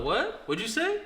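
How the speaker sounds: high-pitched, incredulous comeback; retold challenge. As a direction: act out challenging someone who just insulted you. Retold confrontation with homeless man.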